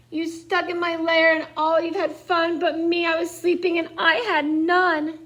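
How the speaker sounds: a sulky bear, lightly whining; whiny bear. Acted. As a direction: moan it like a grumpy woken bear. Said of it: "he whimpers and he moans".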